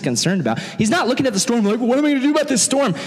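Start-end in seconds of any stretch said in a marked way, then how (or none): 1.8-2.9 high, rapid fretting; quick burst of put-on panic; mock freaking out. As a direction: imitate someone flustered and helpless. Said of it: "He wasn't freaking out about the storm".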